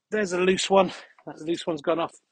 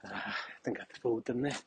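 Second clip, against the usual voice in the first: much softer, fast aside; conspiratorial aside; 4 semitones lower, 10 dB quieter, faster than usual. Real, not acted. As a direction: murmur it like a secret. Aside after passing celebrities.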